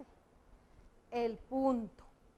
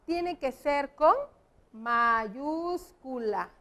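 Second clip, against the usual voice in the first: "mayúscula" drawn out slowly, every syllable; teacher stressing "mayúscula" slowly; slow emphatic mayúscula; much slower than usual. Shown as a demonstration. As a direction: stretch out "mayúscula" like dictating to the class. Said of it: "teacher stresses capital letter".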